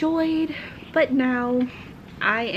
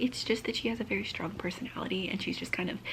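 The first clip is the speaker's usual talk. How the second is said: whisper; 3 semitones lower, 9 dB quieter, faster than usual. Real, not acted. Quiet aside about her sister.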